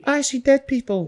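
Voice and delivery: put-on high, hushed little boy whisper; spooky child, barely above a breath; creepy kid voice. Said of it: "the little boy who was like".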